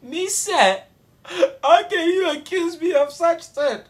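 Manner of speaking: high, loud, whiny protest with a crying edge; hammed-up teary denial to the teacher; mock outraged innocence. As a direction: play the wronged innocent. Accused boy protests innocence.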